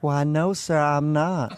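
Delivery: hammed-up Southern belle accent, slow on sir. Host imitating Brittany with a mock Southern accent. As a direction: drawl it out like a sweet Southern girl. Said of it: "really cute Southern accent".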